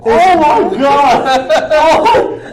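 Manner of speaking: loud, high burst of laughter; laughing loudly. Real, not acted. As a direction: shriek through laughter.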